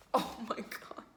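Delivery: voiceless, breaking into laughter; laughing disbelief. Real, not acted. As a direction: gasp it out as laughter takes over. Laughing realization of bad timing.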